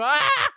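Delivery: sudden high yelp of mock terror; mock scream. Joking about a sniper, a sudden mock scream. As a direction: shriek as if the sniper fired.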